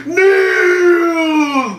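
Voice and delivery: very long, high, loud bellow; sudden villain cry. Acted. Zod breaking off mid-line, sudden long bellow. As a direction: erupt into a mock howl. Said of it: "you wouldn't even seen it coming".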